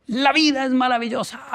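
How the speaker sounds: imitated exuberant teacher. Imitating the teacher in every class, exuberant high cry. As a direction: proclaim it like an eccentric teacher. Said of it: "toda la clase era así".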